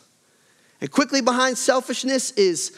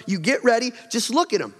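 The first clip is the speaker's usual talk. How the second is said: ordinary talk, nothing marked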